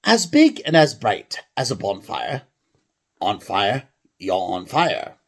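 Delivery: put-on amateur English accent, declaiming. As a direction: proclaim it like an Englishman. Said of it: "a normal English accent".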